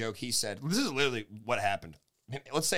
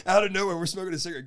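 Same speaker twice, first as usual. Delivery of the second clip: ordinary talk, nothing marked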